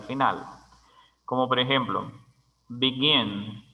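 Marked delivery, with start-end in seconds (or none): 2.7-3.6 "begin" with the weight on GIN; stress on last syllable. Shown as a demonstration. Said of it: "la última es la que tiene el énfasis".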